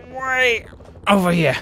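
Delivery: frustrated yelling at riders in the way; furious high shout; angry yell. Real, not acted. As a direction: shout them out of the way, pitch climbing.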